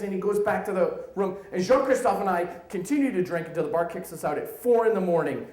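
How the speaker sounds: normal talking voice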